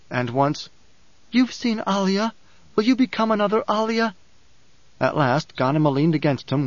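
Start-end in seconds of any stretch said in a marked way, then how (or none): 1.3-4.2 high, urgent appeal; pleading. A narrator's reading. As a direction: plead with her in a raised pitch. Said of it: "the pleading".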